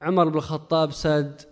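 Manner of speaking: ordinary talk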